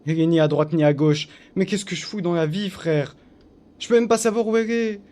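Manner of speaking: loud, high exasperated rant at himself; frustrated yelling. Real, not acted. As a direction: yell in disbelief at your own failure. Carte introuvable, énervé. (cris d'exaspération contre lui-même)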